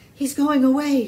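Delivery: high, loud breathless line; panting. A narrator's reading. Annie panting after the dash, breathless. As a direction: blurt it out of breath. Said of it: "she said, panting".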